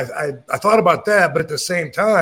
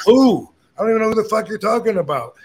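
incredulous denial; 6 semitones higher, faster than usual. Retold or imitated. Accused man denying he knows who's meant, retold incredulity. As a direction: act out baffled indignation, pitched up.

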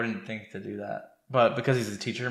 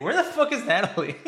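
laughing disbelief; 9 semitones higher, 4 dB louder than usual. Real, not acted. Laughing disbelief at missing character.

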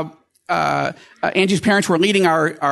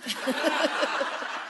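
quiet breathy chuckle at herself; gentle laugh mid-story; soft laugh; 10 semitones higher, 10 dB quieter than usual. Real, not acted. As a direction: let a soft amused laugh slip out.

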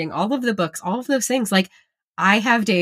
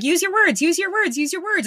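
higher, sing-song repeated urging; nagging parent; 11 semitones higher than usual. Retold or imitated. Parent prodding a child to talk, repetitive sing-song. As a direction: say it over and over.

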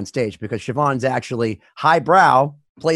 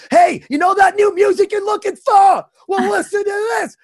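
high, excited shouting into a phone; Marvin Berry phone call; 4 dB louder than usual. Retold or imitated. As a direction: yell it down the line like a thrilled bandleader. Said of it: "that guy in Back to the Future calling".

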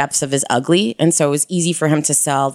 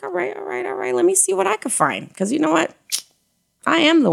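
playful self-talk; 14 semitones higher than usual. Retold or imitated. Her own playful self-talk swiping.

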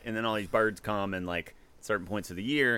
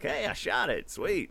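put-on proud kid; 6 semitones higher, slower than usual. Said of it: "I'm like".

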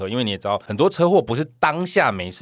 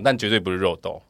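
ordinary delivery

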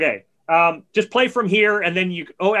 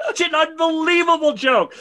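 higher, laughing through the words; amused laughing remark; 9 semitones higher than usual. Real, not acted. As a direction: laugh as you say it. Laughing at the joke.

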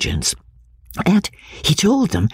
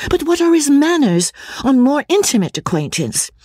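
higher, pressing indignant questions; lightly voiced indignant girl. A narrator's reading. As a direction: push him for more, offended. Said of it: "cried Marianne indignantly".

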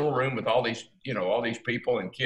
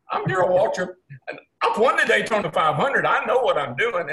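much higher, louder, laughing proud; laughing boast; 10 semitones higher, 7 dB louder than usual. Retold or imitated. Telling the security men who he is, laughing boast. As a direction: announce yourself with a laughing brag.

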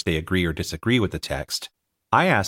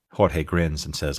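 ordinary delivery

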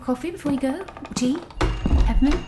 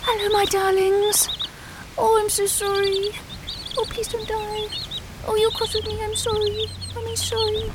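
put-on higher, soft cooing baby talk; cooing to birds; 7 semitones higher, 4 dB quieter than usual. Covering the aviary, cooing higher to her birds. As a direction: coo at your pets like babies.

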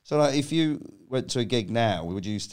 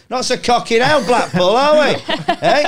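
much higher, louder hammy gloat; gloating shout; 11 semitones higher, 10 dB louder than usual. Retold or imitated. Taunting the booing Blackpool audience, gloating shout. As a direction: crow it at the whole crowd.

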